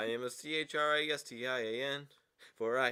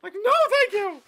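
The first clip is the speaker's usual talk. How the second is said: cartoon voice; 16 dB louder than usual. Cartoon voice, refusing.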